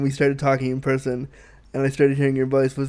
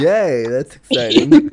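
sarcastic cheer; 5 semitones higher, 5 dB louder, slower than usual. Acted.

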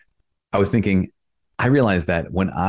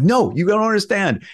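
exasperated insistence; 14 semitones higher than usual. Retold or imitated. Insisting to siblings.

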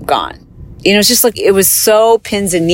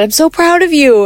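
much higher, tender burst of pride; proud; 10 semitones higher than usual. Retold or imitated. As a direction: retell telling him she's proud. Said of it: "And I just said".

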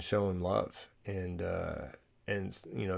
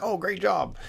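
mock praise; 10 semitones higher than usual. Wife expected to praise him.

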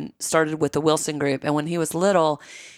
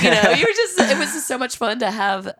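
laughing; 5 semitones higher, 3 dB louder than usual. Real, not acted. Fond memory of little boy.

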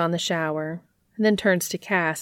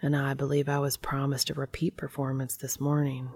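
whispering; 3 semitones lower, 6 dB quieter than usual. A narrator's reading. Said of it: "Dean whispers hotly in his ear".

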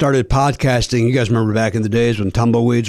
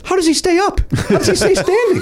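incredulous. Real, not acted. Incredulous joke about tiny feet.

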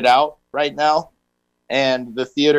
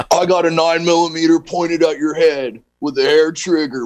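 tough guy movie voice; 3 semitones higher than usual. Retold or imitated. Quoting Keanu movie line.

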